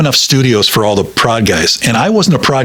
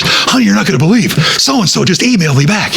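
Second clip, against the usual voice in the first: higher-pitched, retold excited news; amazed announcement; 8 semitones higher than usual. Husband to wife upstairs with news of an email, excited. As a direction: re-enact bursting in with surprising news. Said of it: "I'm always surprised when I'll go upstairs".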